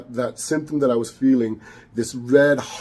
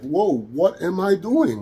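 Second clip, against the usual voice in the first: startled realization; 6 semitones higher, slower than usual. Retold or imitated.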